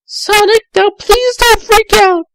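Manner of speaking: put-on high, fast girlish voice; Tails, sweet and eager; young girl Tails. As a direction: chirp it quickly like a young fox girl. Tails warns Sonic.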